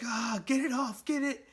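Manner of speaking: flailing, desperate to shake it off; panicked hero. Retold or imitated. Spider-Man with a bomb on his leg, high panicked yelp. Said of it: "Spider-Man's like".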